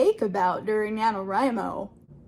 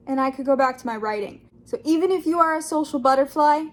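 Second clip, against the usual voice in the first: ordinary talk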